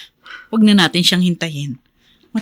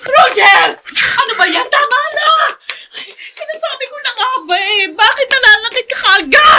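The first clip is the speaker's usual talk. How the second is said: frantic shout. Acted. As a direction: yell at him to stop the beating. Mother stops husband hitting son.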